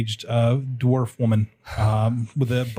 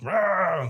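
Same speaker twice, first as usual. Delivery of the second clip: imitated angry roar, higher pitched; 7 semitones higher than usual. Angry noise heard from the kitchen, mock roar. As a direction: mimic a roar from the next room. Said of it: "like, like, angry".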